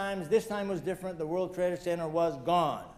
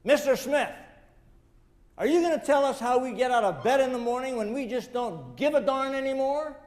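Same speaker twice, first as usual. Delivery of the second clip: higher and louder, calling out across the room; retold shout; 6 semitones higher, 4 dB louder than usual. Fireman calls out to the speaker before a crowd, raised voice. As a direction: demand an answer from the stage. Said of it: "shouts at me in front of all these people".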